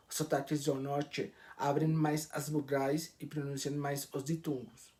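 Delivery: ordinary delivery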